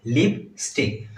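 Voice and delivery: "लिपस्टिक" said slowly and clearly, syllable by syllable; correct pronunciation. Shown as a demonstration. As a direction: spell out "लिपस्टिक" slowly like a teacher. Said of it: "लिपस्टिक बोलना है". ("लिपस्टिक" धीरे और साफ़ बोलें)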